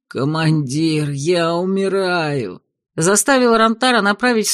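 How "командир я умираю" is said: slow, theatrical fake dying; melodramatic dying cry. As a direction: ham up a dying plea. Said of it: "Возглас".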